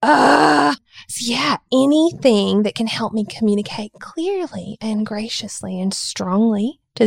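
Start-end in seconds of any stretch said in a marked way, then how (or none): 0.0-0.8 exasperated groan. Retold or imitated. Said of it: "And I was like".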